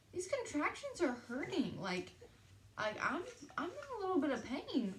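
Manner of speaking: high, strained, hurting voice; retold pained groaning complaint. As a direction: whine it out like each contraction stings. In pain on the drive.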